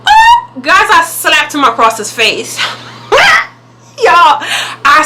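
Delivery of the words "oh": high, loud offended "oh"; sudden high-pitched cry of outrage; shocked "oh". Retold or imitated. Storyteller reacts to the insult before slapping him, shocked.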